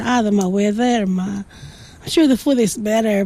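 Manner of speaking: ordinary delivery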